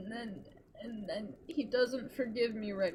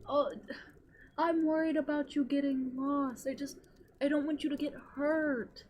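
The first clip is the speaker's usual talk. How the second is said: fretful high mock worry; 7 semitones higher, 6 dB louder than usual. Tighnari fretting over lost Kaya.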